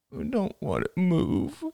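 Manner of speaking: put-on slow, small sulky kid voice; whiny child. A narrator's reading. Son refusing his mother, slow whine. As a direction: pout it out.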